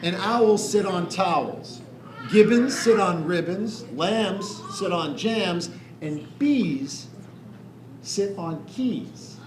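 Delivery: put-on slow, sing-song rhyme reading to children; slow rhyming read-aloud. A narrator's reading. Reading a rhyming picture book to children, slow and sing-song. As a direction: lay each rhyme out slowly for the kids.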